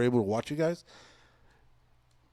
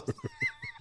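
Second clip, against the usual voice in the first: high laugh; 10 dB quieter than usual. Real, not acted. Laughs at own story.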